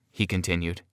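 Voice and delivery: ordinary delivery, nothing marked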